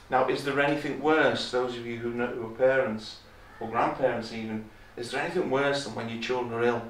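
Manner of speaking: ordinary talk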